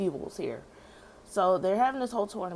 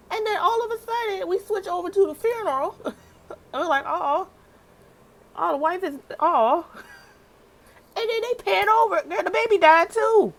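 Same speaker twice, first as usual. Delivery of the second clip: much higher and louder, rising disbelief; shocked excitement; 14 semitones higher, 8 dB louder than usual. Real, not acted. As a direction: tell it like you can't believe it. Shocked by the twist.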